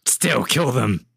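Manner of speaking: drawn-out, sinister orb command; evil orb voice. Acted. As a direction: stretch it out like an evil spirit. Orb voice answers the player.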